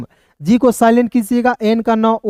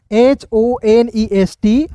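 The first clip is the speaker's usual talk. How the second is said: letters spelled out very slowly, one by one; very slow letter-by-letter spelling; much slower than usual. Shown as a demonstration. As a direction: spell each letter slowly and clearly for students. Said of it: "slow spelling out letters".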